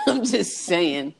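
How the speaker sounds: drawn-out words through a laugh; amused, stretched-out protest. Real, not acted. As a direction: say it slowly, laughing. Laughing while defending her joke.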